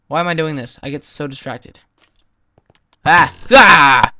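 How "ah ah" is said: sudden loud yelp of fright; two sharp frightened screams; startled scream. Real, not acted. Startled by something in cave.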